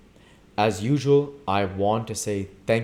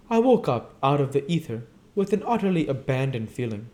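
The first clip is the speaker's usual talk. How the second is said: higher, lively society lady voice; a light, breathy-free high Daisy; 6 semitones higher than usual. Acted. Daisy tells of her daughter.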